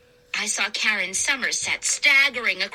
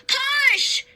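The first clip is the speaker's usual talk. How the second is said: yelled push; 13 semitones higher than usual. A narrator's reading. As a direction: yell with all strength. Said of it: "I yelled".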